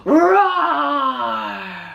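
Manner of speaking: a big playful roar; lion roar. Shown as a demonstration. Teacher shows the children a lion's roar, playful. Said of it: "That's right".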